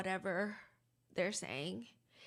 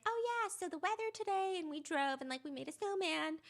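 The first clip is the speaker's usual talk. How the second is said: chirpy mom; 7 semitones higher than usual. Retold or imitated. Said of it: "his mom being like".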